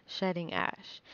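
plain reading voice

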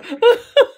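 loud, very high laugh; big laugh. Real, not acted. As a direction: shriek with laughter. Laughing at the teasing.

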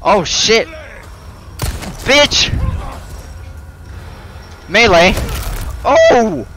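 high panicked shouts; startled yelling. Real, not acted. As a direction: yelp in sudden fright. Startled by enemy attack.

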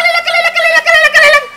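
very high-pitched rapid repetition; shrill silencing outburst. Acted. Landlady shuts up her complaining tenant, shrill silencing outburst. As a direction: drown the other person out in a piercing high voice.

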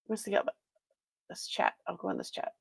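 whispered aside. Real, not acted. Streamer whispering to herself while hunting for the chat. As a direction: mutter it under your breath to yourself.

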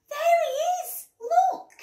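very high, loud excited yell. A narrator's reading. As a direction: shriek it to make the parents look. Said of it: "Daisy yelled".

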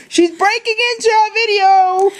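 high-pitched playful panic; mock alarm. Host reporting a girl breaking in, mock alarm. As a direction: sound the alarm, hammed-up and high.

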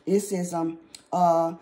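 ordinary talk

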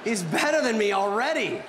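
long, slow, high cry of envy; frustrated outburst. Retold or imitated. Comedian watching a friend kill on stage, envious groan. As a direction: curse it out like a jealous rival. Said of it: "I was saying to myself".

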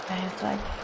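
talking low. Real, not acted. Player hiding from the ghost, speaking very softly. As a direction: keep it down so the ghost won't hear. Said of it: "eu tenho que parar de falar baixo".

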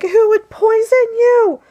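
very high, loud mock wail; freaking out. Owner asking her cat about poisoning, hammed-up freak-out. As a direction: cry it out in exaggerated, high-pitched disbelief. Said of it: "freaking out".

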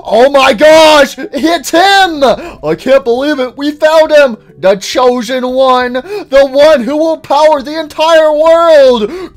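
high, loud hammed-up awe; awestruck excitement. Followers finding the chosen one, awestruck excitement. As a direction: cry it out like a fanatic.

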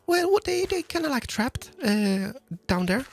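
high, flustered stammer. Pretend. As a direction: stammer it out, caught out.

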